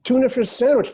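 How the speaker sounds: sudden excited blurt with a swear; excited outburst. Real, not acted. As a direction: blurt it out, carried away. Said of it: "did I say that out loud?".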